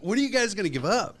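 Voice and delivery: high, fast exasperated outburst. Retold or imitated. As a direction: throw up your hands, voice climbing high. Retold exasperated outburst at boss.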